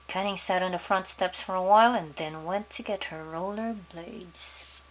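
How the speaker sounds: usual speaking voice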